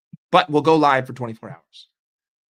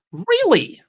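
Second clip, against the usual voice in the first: mock disbelief; 6 dB louder than usual. Real, not acted. Sarcastic reaction to news.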